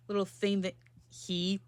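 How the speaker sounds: ordinary delivery, nothing marked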